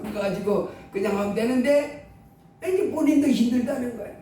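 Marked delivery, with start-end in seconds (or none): none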